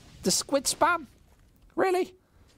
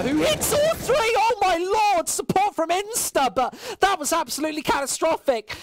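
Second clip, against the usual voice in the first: much higher, louder shout of amazement; screaming hype call; 13 semitones higher, 5 dB louder than usual. Real, not acted. Caster erupting at a triple kill, screaming hype. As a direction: lose it over the triple.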